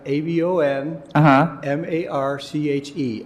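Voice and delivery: slow, deliberate letter-by-letter spelling. Shown as a demonstration. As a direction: spell each letter slowly and clearly. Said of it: "can you spell it for me".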